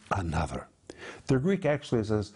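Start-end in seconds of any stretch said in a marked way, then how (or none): 0.1-0.7 whispered ending. Acted. Dramatic question ending in whisper.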